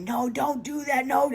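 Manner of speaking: high-pitched, imitating a panicked sister; frantic sister's plea. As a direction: squeak out a desperate no, mimicking her. Sister begs him to stop.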